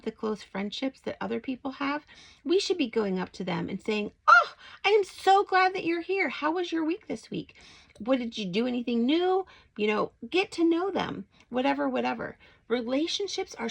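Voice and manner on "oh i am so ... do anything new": warm welcome. Shown as a demonstration. Modelling greeting church members.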